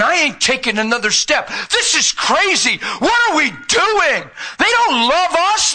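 Jesus pleading, worn out and done; exasperated Jesus. Acted. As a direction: cry out like a man at the end of his rope. Preacher voicing Jesus exasperated.

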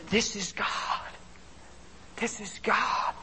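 soft, awed inner voice; hushed thought. Retold or imitated. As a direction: say it to yourself in quiet awe. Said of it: "I'm thinking".

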